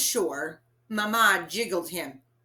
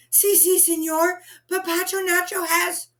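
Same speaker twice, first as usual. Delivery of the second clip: urgent pleading; 10 semitones higher, 6 dB louder than usual. Acted.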